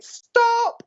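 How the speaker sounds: high retold shout of stop; mock shouted stop. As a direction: bark stop like spirit's command. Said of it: "instantly I got shouted".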